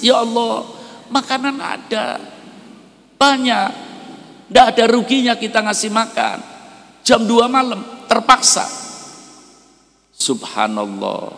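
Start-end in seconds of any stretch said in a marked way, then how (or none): 0.0-8.7 much higher, louder crying pleading; tearful plea. Acted. Kind wife weeps to God over the beggar, high and tearful. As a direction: cry out to God in pity. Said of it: "Nangis istrinya".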